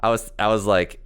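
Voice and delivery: ordinary delivery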